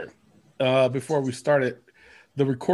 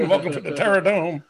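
[name] quote; faster than usual. Retold or imitated. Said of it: "I went [name] there".

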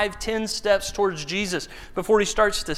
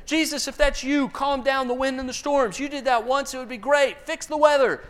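disciples hailing Jesus; 7 semitones higher than usual. Acted. Acting out disciples' requests to Jesus.

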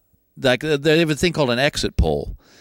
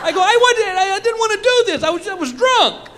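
panicked disbelief. Retold or imitated. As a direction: squeal it in disbelief. Panicked protest about earring.

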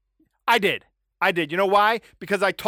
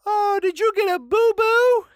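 much higher, louder, slow sing-song coo; cooing baby talk; 8 dB louder than usual. Retold or imitated. Neighbor fussing over the toddler's knee, sing-song coo. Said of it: "looks at her and goes".

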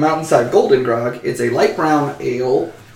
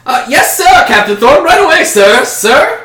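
much higher, loud and flustered; high flustered servant; 12 semitones higher, 9 dB louder than usual. Acted. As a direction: squeak out a nervous yes sir. Otto answers the captain nervously.

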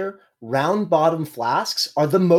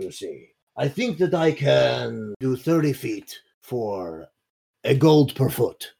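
put-on worsening Russian accent; 3 semitones lower than usual. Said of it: "It progressively gets worse".

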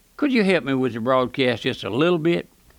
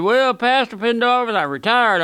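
lightly voiced preacher friend, higher pitch; quoted friend, pitched up a little; 7 semitones higher, 3 dB louder than usual. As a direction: imitate a fellow pastor announcing his news. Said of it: "I met a preacher in this drugstore".

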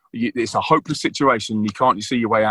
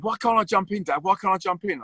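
put-on higher childlike pleading; little boy asking; 6 semitones higher than usual. Dad voices Jack begging to jump in the river, higher-pitched. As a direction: ask like a curious small kid. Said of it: "he said".

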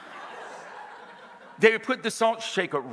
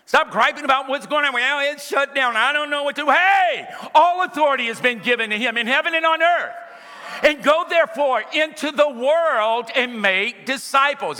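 raised, driving pulpit voice building to shouts; full-force preaching; 5 semitones higher than usual. Acted. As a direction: preach it hard to the congregation.

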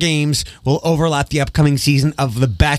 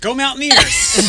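mock cheer; 10 semitones higher, slower than usual. Cheering his college team on air.

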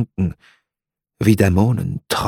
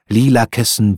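usual speaking voice

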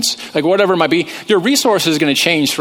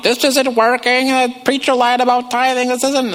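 mock panic, pitched way up; freaking out; 10 semitones higher than usual. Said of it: "They start freaking out".

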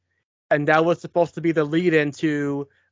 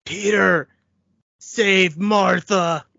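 slow, drawn-out hammed-up plea; mock-dramatic movie plea; 3 semitones higher, much slower than usual. Co-host jokingly quoting a film plea, mock-dramatic. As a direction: stretch it out like an overwrought film line.

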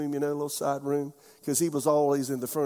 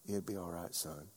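pastor's comfort; 9 semitones lower, 17 dB quieter than usual. Retold or imitated.

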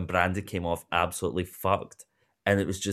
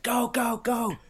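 much higher, urgent mock yelling; frantic producers; 12 semitones higher, slower than usual. Imagined producers rushing a new cast member, frantic. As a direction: hurry someone along in a panic. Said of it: "the producers have been like".

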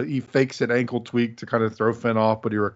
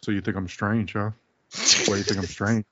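put-on Canadian accent; 2 semitones lower, 4 dB quieter than usual. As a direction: say it like a somber Canadian heel. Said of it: "in this Canadian accent".

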